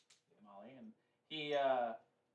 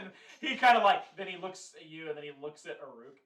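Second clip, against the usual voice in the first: loud, higher narration broken by laughter; laughing; 6 semitones higher, 11 dB louder than usual. Real, not acted. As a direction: laugh your way through the description. Game master laughs while narrating looks.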